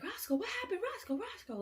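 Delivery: high, alarmed calling of the dog's name; frightened owner crying out for her pet; freaking out. Retold or imitated. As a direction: panic as you call for your hurt dog. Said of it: "like, freaking out".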